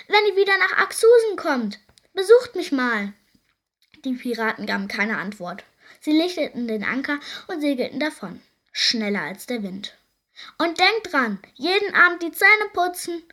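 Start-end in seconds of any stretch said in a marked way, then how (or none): 0.1-3.1 high, loud call after someone. A narrator's reading. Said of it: "und rief". 10.6-13.2 high, loud parting call; calling after them. A narrator's reading. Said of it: "rief Lottchen Pfannenstiel ihnen nach".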